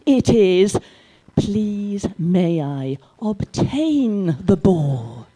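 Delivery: very slow, overly careful model sentence; slow, over-precise correction. Retold or imitated. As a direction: spell out the proper phrasing painfully slowly.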